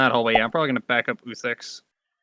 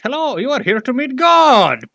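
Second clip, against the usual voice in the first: high, loud and slow, a booming welcome; grand creature greeting; 10 semitones higher, 5 dB louder, slower than usual. Acted. Golem greets the intruders in a high, slow, booming voice. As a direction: announce it like a looming deity.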